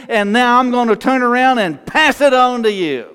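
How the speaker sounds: rising sermon climax, drawing out key words; impassioned preaching. Acted. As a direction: build to a high, ringing declaration of faith. Preaches building declaration of faith.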